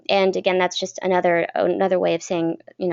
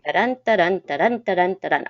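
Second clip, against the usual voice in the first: da-dun, da-dun with the stress on dun; iambic rhythm. Shown as a demonstration. Teacher showing Shakespeare's iambic pentameter, rhythmic. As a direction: tap out the iambic beat. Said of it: "iambic pentameter, Shakespeare".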